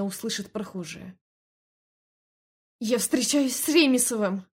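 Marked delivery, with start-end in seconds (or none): 2.8-4.5 loud desperate shout, higher. Acted. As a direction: shout it while struggling. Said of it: "выкрикнула я". (громкий крик)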